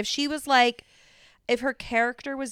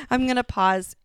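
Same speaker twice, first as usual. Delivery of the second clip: ordinary talk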